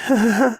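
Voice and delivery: high, forced giggle; nervous little laugh. Acted. Frightened girl laughs nervously at the shopkeeper.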